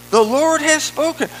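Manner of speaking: loud, high proclamation; declaimed scripture. Acted. Preacher repeats why he can't help but speak, declaiming. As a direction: drive the verse home forcefully.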